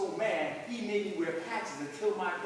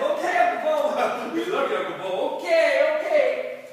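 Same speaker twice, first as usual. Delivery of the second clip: loud, high, embarrassed calling back; 9 semitones higher, 13 dB louder than usual. Acted. Kids calling back to their drunk uncle, loud and embarrassed. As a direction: shout the goodbye to hurry him off.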